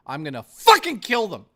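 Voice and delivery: loud, high mock threat; angry shout. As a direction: blow up over the order. Threatening over a food order.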